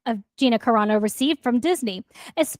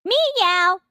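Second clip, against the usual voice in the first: cat meow; 6 semitones higher than usual. Acted. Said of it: "officially a cat girl".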